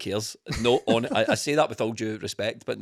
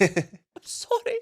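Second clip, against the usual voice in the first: much higher, very slow stretched sorry; mock apology; much slower than usual. Mocking the player's non-celebration to co-hosts, high stretched sorry. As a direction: draw the sorry out high and pleading, hammed-up. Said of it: "He went".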